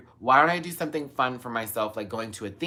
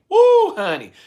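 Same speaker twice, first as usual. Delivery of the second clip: excited; 13 dB louder, slower than usual. Real, not acted. Whooping over pound cake.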